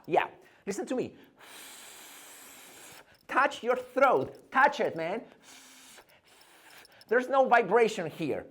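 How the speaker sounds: usual speaking voice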